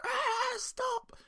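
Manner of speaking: put-on high whiny kid wail; crying kid. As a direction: whine it like a crying child. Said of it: "kids crying like".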